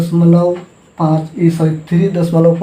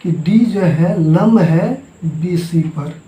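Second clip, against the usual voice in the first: slow teaching emphasis; much slower than usual. Shown as a demonstration. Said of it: "तो हम क्या बोलेंगे".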